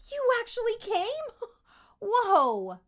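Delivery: high, loud, amazed with a laugh; excited kid. Shown as a demonstration. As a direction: burst out in childlike delight. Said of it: "You're gonna be more like".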